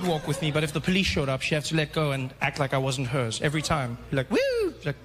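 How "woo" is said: high-pitched "woo"; quick high whistle-like woo, playing innocent; innocent whoop. Mother pretending he isn't hers.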